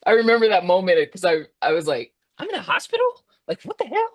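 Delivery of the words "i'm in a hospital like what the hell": very high-pitched mock disbelief; acted bewilderment. Patient reenacting waking in the ICU, bewildered. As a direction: squeal it as a stunned, confused question.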